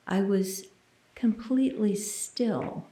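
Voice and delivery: ordinary talk, nothing marked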